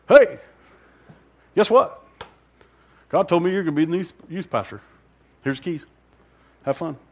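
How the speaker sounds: breezy pastor voice, popping high and loud on the greeting, then tossing off the rest; lightly voiced easygoing pastor, cheery at the start; Royce impression. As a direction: play the casual pastor handing off a job. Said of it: "that's a good Royce impersonation".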